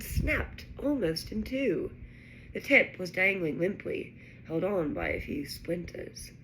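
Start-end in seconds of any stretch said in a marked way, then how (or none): none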